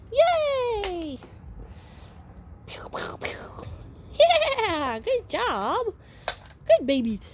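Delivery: high, bright cheer for the pups; excited puppy praise. Real, not acted. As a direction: cheer them on like they won something. Cheering puppies.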